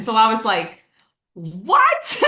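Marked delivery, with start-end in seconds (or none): 1.3-2.2 a mock-stunned "What?"; retold surprise. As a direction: replay your disbelief at the price, half-playful. Retold reaction to the CEO's price.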